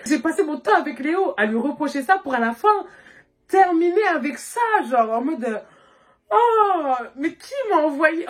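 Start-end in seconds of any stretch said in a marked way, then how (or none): 6.3-8.3 exasperated inner voice. Retold or imitated. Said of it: "dans ma tête".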